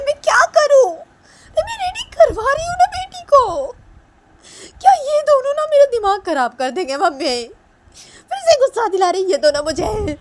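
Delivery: very high, loud, wailing tantrum; Manisha at breaking point. Acted. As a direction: wail in exasperation, far above normal pitch. Manisha's complaint peaks in tantrum.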